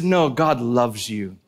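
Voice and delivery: speaking gently to the cashier, tender and slow. Retold or imitated. Telling cashier God loves her.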